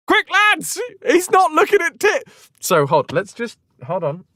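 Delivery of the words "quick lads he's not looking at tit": very high urgent call; rally the lads in comic panic; squeaky-high hammed-up urgency; mock alarm. Warriors raise the alarm.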